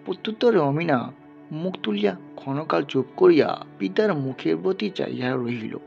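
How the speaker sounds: ordinary delivery, nothing marked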